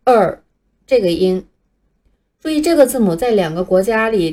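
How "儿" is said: "儿" as a bare, curled "er" sound; isolated r colouring. Shown as a demonstration. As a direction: punch out "儿" alone, louder. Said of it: "并不存在".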